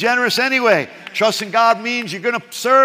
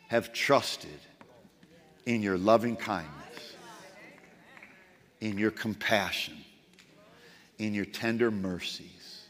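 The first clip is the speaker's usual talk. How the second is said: much softer and lower, hushed devotion; quiet trusting prayer; 11 semitones lower, 9 dB quieter than usual. Acted. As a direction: pray it gently under your breath.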